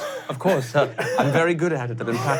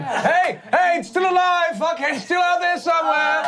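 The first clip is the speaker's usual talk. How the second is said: high excited shout. Acted. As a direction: yell it back to the party. Calling back to the others.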